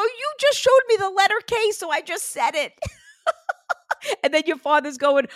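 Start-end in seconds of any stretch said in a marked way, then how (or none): 0.0-2.9 high, loud laughing retell. Retold or imitated. Medium telling client her reply, laughing. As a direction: laugh through the words.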